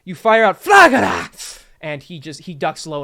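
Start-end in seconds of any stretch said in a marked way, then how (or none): 0.2-1.6 slow, loud booming narration; dramatic shout. Acted. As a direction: call out the spell with gusto. GM narrating dramatic spell attack.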